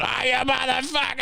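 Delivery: very high mocking trucker curse; mock trucker snarl. Worker mimicking the trucker's entrance curse. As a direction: sneer it like the raging trucker.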